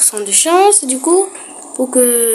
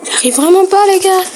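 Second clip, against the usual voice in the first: frustrated; 8 semitones higher, 6 dB louder than usual. Real, not acted. Frustrated calling out.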